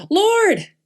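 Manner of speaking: high, loud cry for help; desperate yell. Acted. Peter sinking in the waves cries to Jesus, desperate yell. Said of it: "he yells out".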